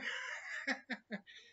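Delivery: high burst of laughter; amused laugh. Real, not acted. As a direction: laugh at your own story. Laughing at own echoing-yell memory.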